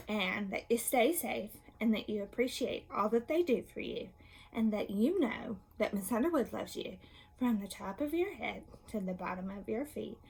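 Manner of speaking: ordinary talk, nothing marked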